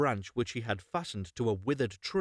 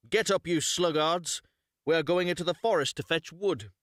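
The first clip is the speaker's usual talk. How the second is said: higher, louder harsh wake-up call; stepmother scolding; 5 semitones higher, 4 dB louder than usual. Acted. Stepmother scolding the sleepy children awake, harsh. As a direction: rouse the lazy children sharply.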